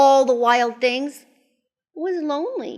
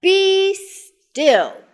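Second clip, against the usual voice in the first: high, loud, spaced-out order; Max's command; 10 semitones higher, 7 dB louder than usual. A narrator's reading. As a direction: order the monsters loudly, word by word. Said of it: "Till Max said".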